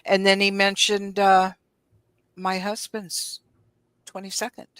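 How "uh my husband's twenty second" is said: normal talking voice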